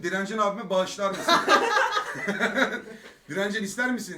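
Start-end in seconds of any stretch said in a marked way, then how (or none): 1.4-3.2 loud high laugh. Real, not acted. Big laugh.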